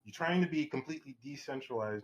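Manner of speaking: ordinary talk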